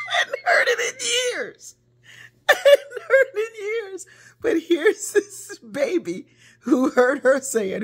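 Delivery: high, loud, teary laughter through the words; overcome, laughing through tears; real emotional laugh-cry. Real, not acted.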